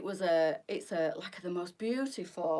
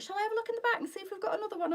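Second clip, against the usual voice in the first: shop assistant; 12 semitones higher, much faster than usual. Retold or imitated. Shop assistant quoted in story.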